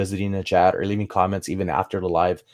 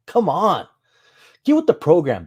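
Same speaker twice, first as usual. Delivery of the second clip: playful scolding; 8 semitones higher, 5 dB louder than usual. Retold or imitated. Teasing wife who doubted him.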